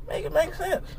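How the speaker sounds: high, rising, soft incredulous question; soft rising make it make sense; exasperated plea. Real, not acted. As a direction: lift it up in disbelief. Exasperated demand, first take.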